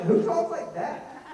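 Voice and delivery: high, loud, drawn-out disbelief; incredulous outcry. Retold or imitated. Comedian objecting to a fake accent, loudly incredulous. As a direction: cry it out in comic exasperation.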